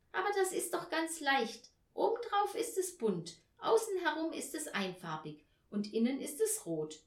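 put-on high-pitched child explaining; lively girl's voice, pitched well up; eager little Tina. Tina giving parents hints about the present, eager.

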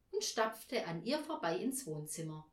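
usual speaking voice

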